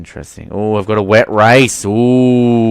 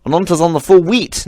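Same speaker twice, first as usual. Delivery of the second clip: mock Kiwi accent; 7 semitones higher than usual. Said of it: "Now I'm speaking like a Kiwi brew".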